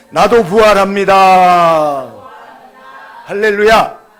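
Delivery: slow, loud, drawn-out shout of faith; loud declaration. Shown as a demonstration. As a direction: call it out slowly for your neighbour to hear. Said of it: "옆 사람에게". (천천히 크게 외치는 고백)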